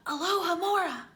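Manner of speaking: ordinary delivery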